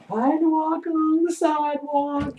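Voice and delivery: high, slow, drawn-out tipsy impression; mock drunk. Acting out drunken walk.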